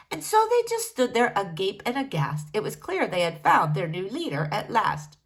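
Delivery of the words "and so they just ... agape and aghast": plain reading voice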